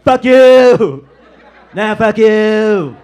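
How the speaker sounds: high, very loud, slow screamed insult; mock furious yell. Trump yelling at everyone from his monitors, hammed-up furious scream. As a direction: scream it like a raging president at the monitors. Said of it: "responds to everything like".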